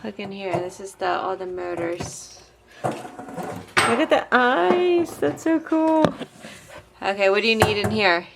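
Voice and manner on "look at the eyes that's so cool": excited. Real, not acted. Seeing robot's motors and eyes.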